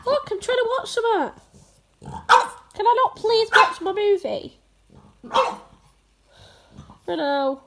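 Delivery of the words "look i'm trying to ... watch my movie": whiny pleading. Real, not acted. Dog pestering her while watching telly.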